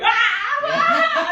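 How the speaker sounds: high, loud squeal of surprise; little girl squealing at the mirror; delighted gasp. Retold or imitated. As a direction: shriek like a kid seeing her new smile. Girl sees new teeth in mirror.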